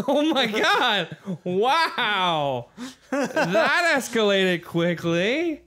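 slow words through laughter; laughing. Real, not acted. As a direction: laugh out the line. Laughing at the absurd scene.

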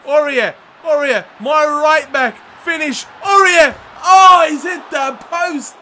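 high, loud calls building to a peak; real breathless-run excitement; excited urging. Real, not acted. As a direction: shout him on, then cry out at the miss. Right back runs, hits post.

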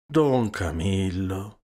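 slow, drawn-out name, rising; measured, patient call; chiding Christ. A narrator's reading. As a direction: stretch the name in gentle reproof. Christ gently chides the priest.

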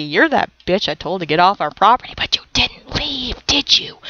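hushed, fast threatening whisper; menacing whisper. Real, not acted. Gamer to a neighbour sim who wouldn't leave, whispered threat.

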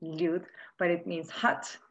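ordinary delivery

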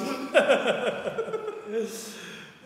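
high burst of laughter; loud laugh. Real, not acted. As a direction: crack up again. Laughing after thanks.

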